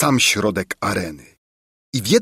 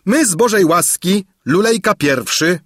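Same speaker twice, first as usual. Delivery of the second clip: high, projected ceremonial announcement; herald's proclamation; 8 semitones higher than usual. A narrator's reading. Herald reading King Lulejka's message, high proclaiming. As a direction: proclaim each title to the whole arena.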